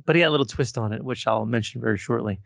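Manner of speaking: ordinary delivery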